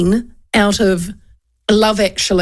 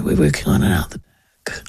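low mumble; 3 dB quieter than usual. Retold or imitated. Mumbling cashier voice.

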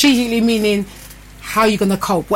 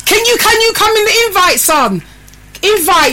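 excited; 9 semitones higher than usual. Real, not acted. Her son joins the live.

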